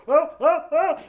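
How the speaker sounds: high loud mock falling cries; fake falling yell. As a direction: yell like you're slipping off. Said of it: "starts pretending to fall".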